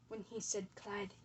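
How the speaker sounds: ordinary talk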